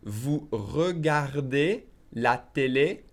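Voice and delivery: very slow French, "regardez" with every syllable sounded; very slow model. Shown as a demonstration. Teacher models the question for learners, very slowly. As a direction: draw out each word, rising at the end.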